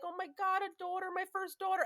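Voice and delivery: high-pitched retold gushing; blissful. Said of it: "looking at her in such bliss".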